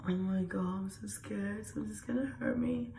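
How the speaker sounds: soft, high, frightened little voice; nervous, scared. Shown as a demonstration. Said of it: "you get the nervous energy".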